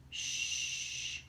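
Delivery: 아이를 달래듯 부드럽게 쉿; 겁먹은 아이 달래듯 아빠에게 속삭이는 쉿 소리; soothing shush. A narrator's reading. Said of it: "like he was a scared little kid".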